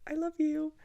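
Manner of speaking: small, high, tender voice; sweet high-pitched confession; little affectionate aside. Acted. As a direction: let a little love slip out, pitched high. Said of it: "it's just, like, a little".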